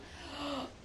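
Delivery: sharp re-enacted gasp; startled gasp. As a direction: gasp as if something fell on your hand. Re-enacts snake falling on her.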